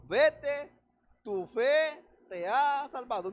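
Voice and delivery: loud, slow ringing declaration; proclaiming. Acted. Jesus sends the healed blind man off, proclaiming loudly and slowly. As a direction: boom it out like a verdict. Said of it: "Y Jesús le dijo".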